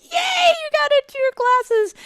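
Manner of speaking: high, loud echoing cheer; loud, squealed retold celebration; joyously happy. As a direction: cheer back with hammed-up joy. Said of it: "joyously happy".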